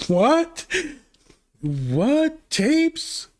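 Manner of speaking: high, incredulous What and Tapes; mock astonishment. Reviewer mocking the sequel's tapes twist, hammed-up disbelief. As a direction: overplay the disbelief.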